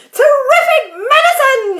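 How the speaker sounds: high, loud crone's cry of glee; old woman shouting. A narrator's reading. Grandma shouting with joy as she grows upward. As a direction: belt it out like a giddy old grandma. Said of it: "she cried".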